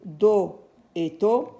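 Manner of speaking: slowly repeating do et to; teacher drilling syllables. Shown as a demonstration. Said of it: "on a do".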